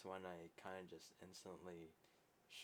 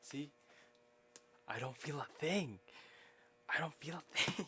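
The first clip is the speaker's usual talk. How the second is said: raised, high declaration, building; loud boast; 7 semitones higher, 13 dB louder than usual. Real, not acted. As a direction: call it out, higher the second time. Taunting boast after friend's knock.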